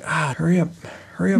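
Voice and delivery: impatient husband calling up. Retold or imitated. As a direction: rush someone along, exasperated. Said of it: "he's getting impatient".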